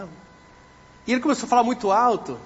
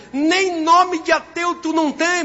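very high, loud, drawn-out put-down; loud scornful disbelief; incredulous mocking shout; 9 semitones higher, 6 dB louder, slower than usual. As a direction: belt out the scoff, stretching it slowly. Policeman's retort, louder repeat.

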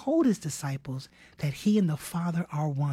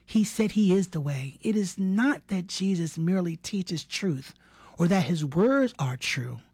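ordinary talk, nothing marked

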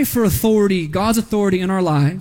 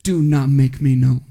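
stern warning; 7 semitones lower than usual. Retold or imitated. Jesus silencing the demons, stern and low. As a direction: imitate a low, authoritative dad-voice order. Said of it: "sternly warns them".

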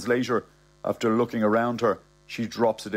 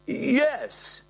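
very high, stretched-out admission; high-pitched, cornered old fella; flustered old man. Acted.

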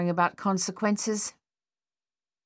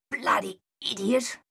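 higher scolding outburst; chastising; 5 semitones higher than usual. Acted. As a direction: tell him off, relieved and cross. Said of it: "Thaddeus chastised".

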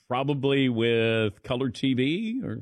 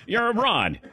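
higher mock vintage film voice; old-movie actor; 9 semitones higher than usual. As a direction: play along as an old-time movie actor. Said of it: "actors also talk like that".

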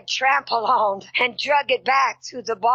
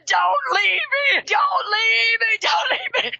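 pleading, rising higher each time; desperate begging; 9 semitones higher than usual. Retold or imitated. Preacher reliving hell, begging the death angel with rising cries. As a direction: cry out not to be left.

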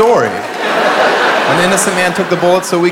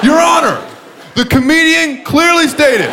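high mock lawyer pleading; 12 semitones higher than usual. Courtroom defense imitation.

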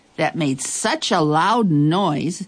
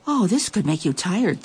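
usual speaking voice

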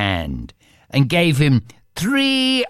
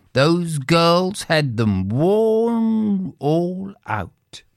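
plain reading voice

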